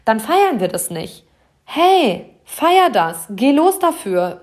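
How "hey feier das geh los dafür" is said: high, loud rallying call to celebrate; excited cheer. Real, not acted. Coach to listener, urging her to celebrate: a high, loud cheer.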